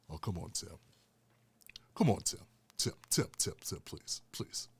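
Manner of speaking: low, hushed ghostly voice; Paul Mooney impression. Comic voicing Paul Mooney's ghost to himself. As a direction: drop low and breathy as a voice from beyond. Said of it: "I can hear Paul Mooney's voice".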